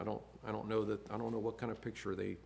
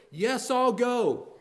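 slow, raised, resolute yes I'll go; voicing Jesus; 12 semitones higher, 11 dB louder, much slower than usual. Preacher voicing Jesus accepting the cross, slow and raised. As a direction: answer as willing Savior.

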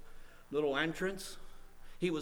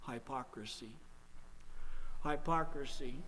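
soft, low, weighty naming; hushed gravity; 3 semitones lower, 8 dB quieter than usual. Real, not acted. Preacher naming a hidden sin to congregation, hushed. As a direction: let the word drop quietly into silence.

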